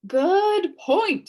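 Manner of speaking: high, loud put-on judge voice; a judge granting a point; pompous judge. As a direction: concede grandly. Judge figure agrees.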